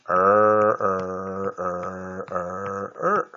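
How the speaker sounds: teacher stretching 儿 to show the long vowel; drawn-out er sound. Shown as a demonstration. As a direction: hold the 儿 sound long to model it. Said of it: "那我们就念".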